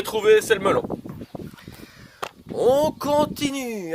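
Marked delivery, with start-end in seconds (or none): 2.5-3.9 slow, higher sing-song call; drawn-out announcement. Acted. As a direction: stretch each sound for the kids. Announces next step, drawn out.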